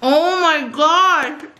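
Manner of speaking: slow, high, delighted gasp; drawn-out thrilled reaction; excited. Real, not acted. Tasting the food.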